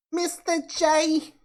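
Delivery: high, slow Brooklyn-style "Mister J."; imitating Harley accent. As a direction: coo "Mister J." like Harley Quinn. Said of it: "mimic her voice more like Margot Robbie".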